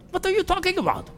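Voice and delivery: high-pitched, bewildered mock voice; baffled friend. Preacher mimics the friend's puzzled reply, high and baffled. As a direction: imitate a confused friend in a high squeak. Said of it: "he looked at me like".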